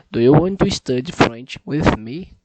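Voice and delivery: loud, deliberate speech into the mic; a second try so the app accepts it, spoken loud; loud careful repeat. Shown as a demonstration. Loud and deliberate so the machine hears. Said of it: "Facilite, meu caro".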